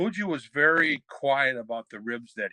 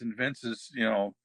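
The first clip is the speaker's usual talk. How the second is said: ordinary delivery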